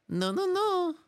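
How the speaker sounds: very high Italian-accented refusal; squeaky mock Italian "no"s; put-on Italian accent. Shown as a demonstration. Host replaying it for a guess, high Italian accent. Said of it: "what kind of accent".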